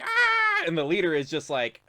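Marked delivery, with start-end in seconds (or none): 0.0-0.6 high, loud kobold scream; short high-pitched battle yelp; kobold war cry. Acted. Kobolds crying out to their leader, high loud shriek. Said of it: "they're like".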